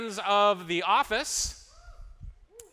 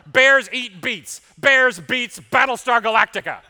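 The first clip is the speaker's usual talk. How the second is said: loud deadpan; 4 semitones higher, 9 dB louder, slower than usual. Retold or imitated. Jim mocking Dwight, pressing on.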